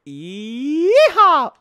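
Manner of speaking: loud high yeehaw; cowboy whoop. Real, not acted. Whoops while jumping.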